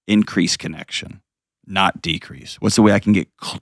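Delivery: normal talking voice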